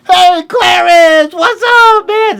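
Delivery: very high, loud, stretched-out hail; shouted greeting. Retold or imitated. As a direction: imitate hollering back to an old friend. Jimi hails his old friend back.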